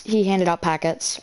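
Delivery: normal talking voice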